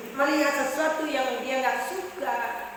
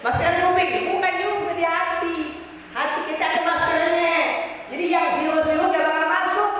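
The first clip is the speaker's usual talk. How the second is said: emphatic preaching; 7 semitones higher, 5 dB louder than usual. Real, not acted. Preacher's rising punchline.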